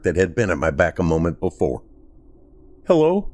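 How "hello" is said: high, timid call into the dark; a scared child asking if anyone is there; small childlike call. A narrator's reading. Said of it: "My voice was so small, almost like a child's".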